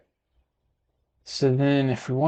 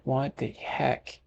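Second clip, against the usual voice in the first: slow, softer mock disbelief; mock indignant; 3 semitones higher, 6 dB quieter, much slower than usual. Retelling a reply to the lady about the accent, mock indignant. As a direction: draw it out in playful protest. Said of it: "And I'm like".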